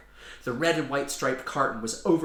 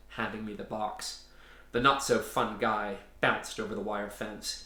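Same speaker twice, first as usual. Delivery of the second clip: ordinary delivery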